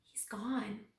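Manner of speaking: urgent breathy whisper; hushed alarmed whisper. A narrator's reading. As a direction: whisper it so no one hears. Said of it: "I whispered to my father".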